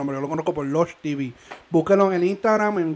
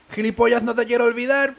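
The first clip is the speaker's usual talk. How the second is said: higher, mock Castilian Spanish accent; Spain accent parody; 5 semitones higher, 3 dB louder than usual. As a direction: put on a hammed-up accent from Spain. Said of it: "reggaetoneros con diferentes acentos".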